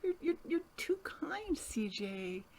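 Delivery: near-whispered thanks; touched, hushed. Real, not acted. Guest answers heartfelt praise of her work, hushed and touched. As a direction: barely voice it, overcome by the compliment.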